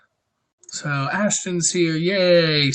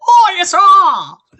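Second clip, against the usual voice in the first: much higher, loud, slow squeaky shout; high-pitched like Jared; 14 semitones higher, 9 dB louder, much slower than usual. Pretend. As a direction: shriek it high and drawn out on request. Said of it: "with a high-pitched voice like Jared".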